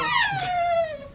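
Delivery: laughing burst. Real, not acted. Laughs after own bit.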